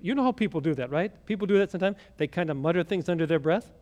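usual speaking voice

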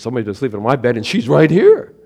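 put-on excited Baby Bear find; Baby Bear discovery. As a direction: build to an excited little-bear shout.

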